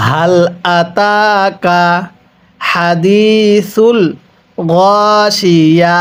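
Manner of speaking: "আতাকা" drawn out very slowly for repeating; slow recitation model. Shown as a demonstration. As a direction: model each word slowly for the class to echo. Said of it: "তাহলে আমার সাথে পড়ুন". (ধীরে টেনে শিখিয়ে পড়া)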